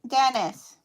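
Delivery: loud call across the house. Real, not acted. Owner calls Dennis downstairs, loud shout. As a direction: shout the name to reach downstairs.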